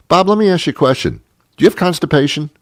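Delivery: high, quick, over-keen question; mock eager questioning. Host springs a personal question on produce man, mock eager. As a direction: pitch it way up, playfully nosy.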